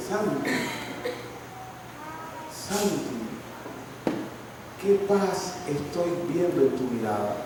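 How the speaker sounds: softly voiced older woman, gentle and quiet; a lightly voiced tender old woman; tender, hushed praise. La señora le habla con cariño. (con ternura y en voz baja)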